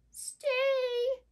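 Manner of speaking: high, drawn-out little whimper; mildly frightened little turtle voice. A narrator's reading. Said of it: "whimpered Turtle Turtle".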